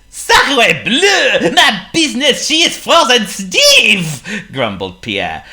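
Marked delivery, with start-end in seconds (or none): 0.1-4.5 put-on loud, high French accent, laughing through his grumble; a French baker fuming over frozen stock; grumpy French accent. French baker complains to the penguin about his frozen business, in a loud French accent. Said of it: "the French-Canadian baker".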